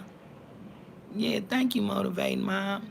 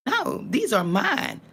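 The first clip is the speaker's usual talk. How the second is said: ordinary talk, nothing marked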